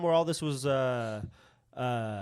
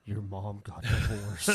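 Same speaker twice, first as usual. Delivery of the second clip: slow, lower teasing jab at a friend; mock taunt; 5 semitones lower, 3 dB quieter, slower than usual. Friend teasing a pal about divorce, slow low mock taunt. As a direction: drawl it out like a kid needling a buddy.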